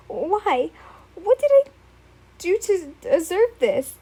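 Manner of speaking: mild acted sobbing, cracking up high. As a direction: wail the question through tears. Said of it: "he cried, sobbing miserably".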